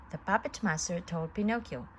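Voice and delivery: usual speaking voice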